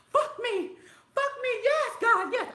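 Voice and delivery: hammed-up yell of relief, laughing through it; mock ecstatic shout. Retelling being kicked out of the car, a laughing mock ecstatic yell.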